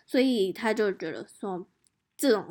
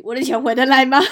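much louder, laughing question; laughing plea; 3 semitones higher, 12 dB louder, faster than usual. Retold or imitated. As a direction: mock-desperate about her money. Asking police, laughing. (笑着急问)

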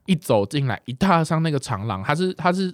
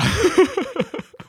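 high giggle. Real, not acted.

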